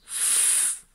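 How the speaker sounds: F as a long, breathed "fff"; isolated F sound. Shown as a demonstration. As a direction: hold F as pure airflow, not the letter name. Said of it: "c'est le son F".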